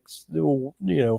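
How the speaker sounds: normal talking voice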